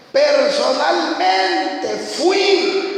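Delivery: "Personalmente" drawn out very slowly and called out high; stress on personalmente. Real, not acted. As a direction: hammer home "Personalmente fui" word by word. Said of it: "Cuando usted dice personalmente".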